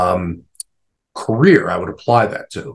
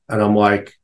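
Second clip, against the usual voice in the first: ordinary talk, nothing marked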